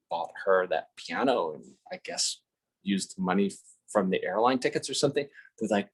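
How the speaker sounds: ordinary talk, nothing marked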